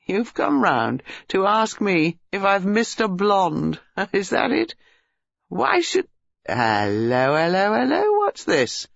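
amused disbelief turning to curious hellos; mocking young man. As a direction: make fun of the question, then get distracted.

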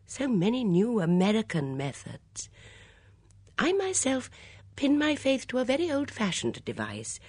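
put-on high-pitched, chatty elderly lady; gentle gossipy old lady. A narrator's reading. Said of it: "charming in her gentle, gossipy fashion".

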